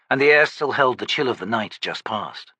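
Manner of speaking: ordinary delivery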